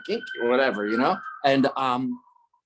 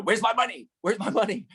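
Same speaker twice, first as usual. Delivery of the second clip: demanding payment; 10 semitones higher than usual. Retold or imitated. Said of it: "he'll be like".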